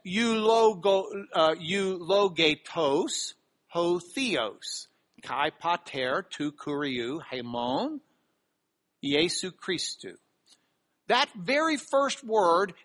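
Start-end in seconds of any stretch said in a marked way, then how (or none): 0.1-10.1 slow careful Greek, eulogetos spelled out sound by sound; reading Greek aloud. Shown as a demonstration. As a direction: sound out the Greek phrase deliberately. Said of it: "It says".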